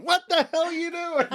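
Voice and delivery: hammed-up exasperation; mock annoyed customer.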